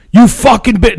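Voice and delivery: half-voiced screaming fit; retold scream. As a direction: imitate dad's angry outburst. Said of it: "screaming, dude, at the top of his lungs".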